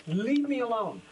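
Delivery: high, loud mock protest; retold exasperated yell. Gamer dad retells shooing his daughter away, mock exasperated yell. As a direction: act out being pestered past patience.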